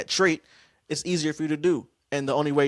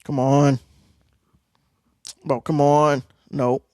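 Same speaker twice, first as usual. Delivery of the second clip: frustrated outburst; 8 dB louder, slower than usual. Real, not acted. Reacting to frustration on stream.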